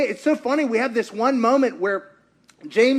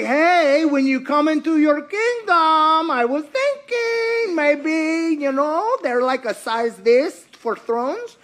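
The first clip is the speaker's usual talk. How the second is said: higher, slow, sweetly wheedling mother; pushy mom; 6 semitones higher, slower than usual. Retold or imitated. Mother angling for thrones for her sons, slow and wheedling. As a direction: coax him like a scheming mother.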